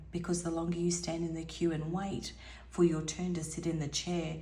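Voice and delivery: normal talking voice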